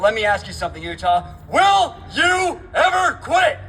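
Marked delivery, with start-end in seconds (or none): 1.5-3.6 shouting to the crowd; rally shout. Real, not acted. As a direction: call it out loud to a crowd. Calls out to the crowd.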